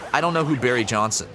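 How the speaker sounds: usual speaking voice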